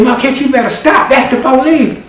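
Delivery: cousin's high plea. Acted. Cousin urging Marquette to stop for police, high plea. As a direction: urge him to stop, high.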